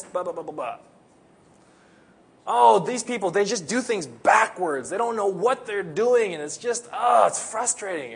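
hammed-up griping worker, loud opening Oh; whiny complainer. Preacher mocks complaining foreign workers, whiny voice.

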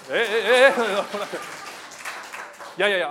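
high, loud startled stammer; flustered surprise. Real, not acted. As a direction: caught off guard, sputtering. Flustered by praise, then laughs.

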